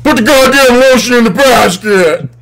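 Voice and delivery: enraged killer's high, loud yell; furious movie villain impression, shouted high; Buffalo Bill. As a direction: scream the order like a movie maniac. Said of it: "I satisfied Nick with my Buffalo Bill".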